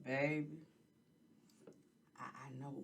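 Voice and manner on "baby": usual speaking voice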